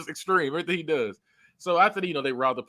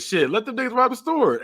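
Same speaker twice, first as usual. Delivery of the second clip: higher, quicker-rising mock reply; acted-out retort; 7 semitones higher than usual. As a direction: throw it out as his quoted comeback, pitched up. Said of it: "And he said".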